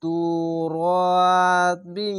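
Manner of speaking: তুরাবিন drawn slowly with a humming nasal end; nasal ghunna recitation. Shown as a demonstration. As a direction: chant তুরাবিন with a held ghunna. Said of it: "এখানে ইকফা গুন্না".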